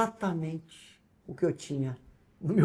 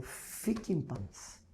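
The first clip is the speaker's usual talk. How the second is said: much softer and slow, calm; God's soft voice; 14 dB quieter, much slower than usual. Retold or imitated. God answering his panicked prayer, very soft and slow. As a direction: breathe it out slowly like divine peace. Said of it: "E Deus falou".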